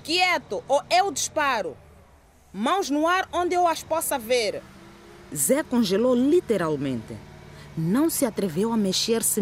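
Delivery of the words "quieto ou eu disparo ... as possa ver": high, forceful police command; shouting. Acted. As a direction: bark the order at him. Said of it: "Uma voz forte gritou".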